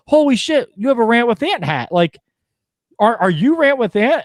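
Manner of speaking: put-on a thrilled young fan recognizing him; starstruck kid.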